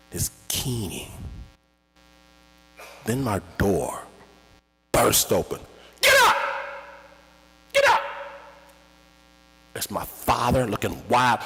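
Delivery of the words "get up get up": very high, loud yell to wake them; frantic shout. Retold or imitated. Father bursting in to wake the boys, frantic shout. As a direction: scream them awake.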